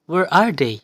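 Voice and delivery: "Where are they?" with "they" hit high and loud, then sliding down; loud teaching demo of falling intonation; high to low slide. Shown as a demonstration. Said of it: "sliding lines to show the shifting of voice from high to low".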